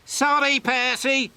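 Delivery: high, loud, dismissive sniff; sniffy refusal. A narrator's reading. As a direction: brush him off haughtily. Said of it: "sniffed James".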